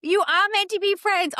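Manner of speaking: high, loud delighted echo; excited echo. Real, not acted. Cohost echoing her friend, high and delighted. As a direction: squeal it back with delight. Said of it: "Oh my gosh, I love that".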